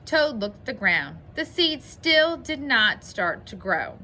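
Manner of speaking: lively storybook narration, bringing out the dialogue; story reading. A narrator's reading. Teacher reads Toad's troubles to boys and girls, animated story reading.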